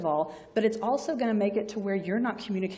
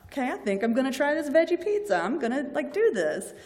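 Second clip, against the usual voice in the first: cheerful agreement; 6 semitones higher, 5 dB louder than usual. Retold or imitated. Patient agreeing after persuasion.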